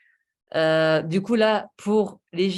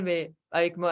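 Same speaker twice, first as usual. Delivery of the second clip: ordinary delivery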